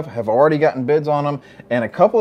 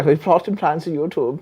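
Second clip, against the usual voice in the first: put-on weak, hammy German accent; 3 semitones higher than usual. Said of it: "that was a terrible German accent".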